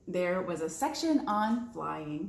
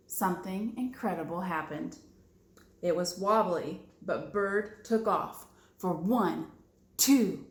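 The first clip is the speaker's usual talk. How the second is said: usual speaking voice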